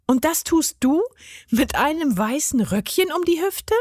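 put-on high-pitched, incredulous girl's voice; teasing question. A narrator's reading. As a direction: tease him, rising high at the end. Maja mocks gnome's skirt.

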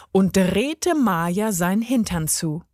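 ordinary delivery

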